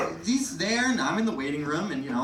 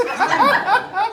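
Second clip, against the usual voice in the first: sudden high loud squeak; squeaky nurse; 11 dB louder than usual. Retold or imitated. Nurse admitting she only applies gel. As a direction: pop up high like a clueless nurse.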